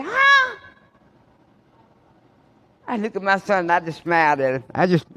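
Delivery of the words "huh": very high, loud startled huh; shocked huh. Retold or imitated. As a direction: son stunned. Son reacts in shock.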